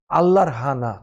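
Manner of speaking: normal talking voice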